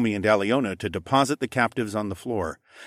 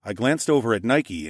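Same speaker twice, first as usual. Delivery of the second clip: ordinary delivery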